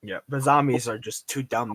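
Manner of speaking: ordinary delivery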